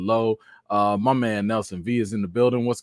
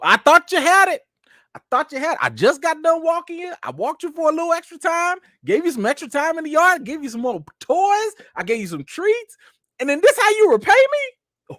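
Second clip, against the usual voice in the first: much higher, louder scolding of the dog; outraged owner telling off his dog in a much higher voice; exasperated scolding; 5 dB louder than usual. Real, not acted. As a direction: scold him like a betrayed parent. Scolding the dog after peeing.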